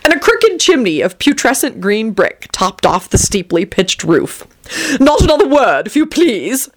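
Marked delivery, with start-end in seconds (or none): 5.0-6.7 grand theatrical declaiming dandy. A narrator's reading. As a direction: declaim it like on stage. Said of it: "Tony declaimed".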